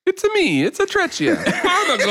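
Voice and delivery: cartoon Italian accent; Super Mario voice. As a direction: ham it up like Mario. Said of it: "sounded like Super Mario".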